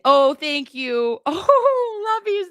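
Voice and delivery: high, drawn-out squeal breaking into laughter; real laughing, touched gratitude; gushing thanks. Real, not acted.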